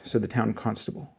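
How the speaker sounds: usual speaking voice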